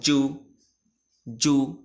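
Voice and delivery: "জু" with lips rounded on the "oo". Shown as a demonstration. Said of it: "জু বলার সময় ঠোঁটটা গোল হবে".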